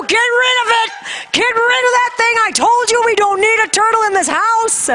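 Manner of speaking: high-pitched panicked mom outburst; re-enacted shrill exasperated tirade; frantic scolding. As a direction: order it out of the house. Panicked mother orders turtle out.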